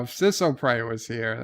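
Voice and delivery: normal talking voice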